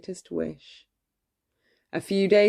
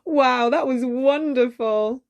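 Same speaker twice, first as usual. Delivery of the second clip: higher, delighted exclamation; happy; 6 semitones higher, 3 dB louder than usual. A narrator's reading. Belle thanking the dancing dishes, delighted. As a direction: gush with delight after the show. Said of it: "Belle was happy and laughing".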